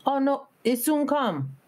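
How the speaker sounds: high, slow, easygoing islander; island lady. Retold or imitated. Island lady answers impatient tourists at the bus, easygoing high drawl. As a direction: imitate an unhurried island woman, voice lifted. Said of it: "I never forget this lady".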